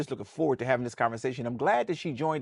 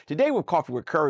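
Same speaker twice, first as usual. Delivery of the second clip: ordinary talk, nothing marked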